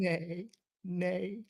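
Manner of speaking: nay said as a high whinny; horse neigh pun. Acted. Teasing co-host over the horseman title, high horsey neigh.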